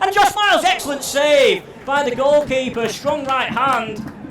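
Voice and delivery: sudden shouted near-miss call; excited shout. Real, not acted. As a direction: burst out at the save. Near goal, great save.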